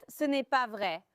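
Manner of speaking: ordinary talk, nothing marked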